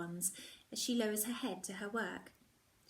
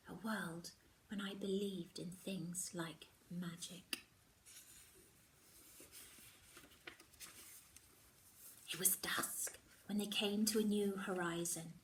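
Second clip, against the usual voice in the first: hushed storytelling; 6 dB quieter than usual. A narrator's reading. Retelling childhood tale.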